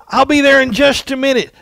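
put-on high, loud kid's shout; boy yelling back. Johnny calling back to the neighbor, high and loud. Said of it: "he yelled back and says".

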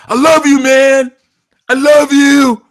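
full-throated high shout of I love you, man; loud Western love. Retold or imitated. Mocking loud Western affection, shouting it to a friend. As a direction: bellow the love like a buddy across a room. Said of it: "See, 'cause Western love is loud".

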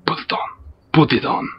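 hushed whispered urging. Acted. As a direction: breathe it like a secret chant. Egging on, hushed.

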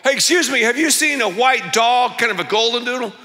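high, eager polite question; hailing a stranger. Acted. Searcher asking a power walker about the lost dog, high hailing voice. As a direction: flag down a passer-by.

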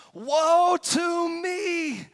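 slow, high, loud lament; crying out. Retold or imitated. Isaiah crying out before God's throne, anguished. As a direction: cry it out in anguish. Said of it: "I cried".

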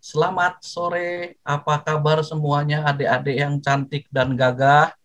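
a slow, friendly "Selamat sore" stretched out clearly; slow clear greeting. Shown as a demonstration. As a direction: welcome the kids slowly and warmly. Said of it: "Harus jelas". ("Selamat sore" diucapkan pelan dan ramah)

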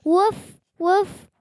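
high, bright little yips of a puppy; puppy woof. Acted. Reading a picture book aloud, puppy Biscuit barks with high yips. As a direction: yap it like a happy puppy.

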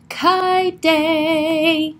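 long, drawn-out storybook shout; excited shout. A narrator's reading. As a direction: call it out slowly, stretching each word. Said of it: "and then shouted".

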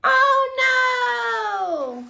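high, loud alarmed oh no; dismayed oh no. Acted. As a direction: cry out as it all falls. Tree falls in the story.

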